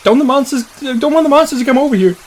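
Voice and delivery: high-pitched little worried voice; scared child. Acted. Lex begging that monsters stay away, high child's voice.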